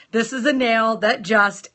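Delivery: normal talking voice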